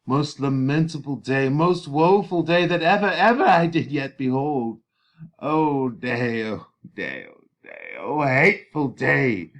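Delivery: put-on loud, wailing lament over a dead girl; a nurse wailing over Juliet's body; grieving nurse. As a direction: cry out to the heavens in mourning. Teacher reads the Nurse's lament.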